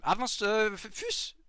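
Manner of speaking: sudden high loud yell; shouting. Real, not acted. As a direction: shout it out in a panic. Urgent battle call.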